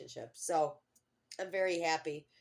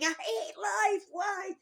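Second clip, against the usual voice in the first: high-pitched, louder, slow wail of despair; reliving her breakdown, crying out in a stretched high wail; retold screaming; 5 dB louder, much slower than usual. Mother telling viewers about her breakdown, imitating her high anguished scream. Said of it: "I'm screaming".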